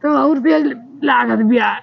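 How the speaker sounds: drawn-out moan at the sting; slow, groaning complaint of pain; pained cry. Acted. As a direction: wail that it stings, without shouting. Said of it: "अरे चिल्ला ना".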